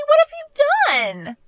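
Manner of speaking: high, loud, slow horrified cry; horrified, as if betrayed; shocked outburst. Acted. Fiancée reacts to the police report, horrified.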